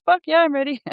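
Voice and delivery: high granny voice, FUCK hit hard; a cheeky old lady, up high; feisty grandma voice. Retold or imitated. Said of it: "So, so the grandma says".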